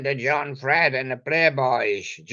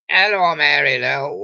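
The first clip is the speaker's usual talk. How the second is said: louder, calling across the street; mock shout; 3 semitones higher, 6 dB louder than usual. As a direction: hail her from the bus stop, half-playful.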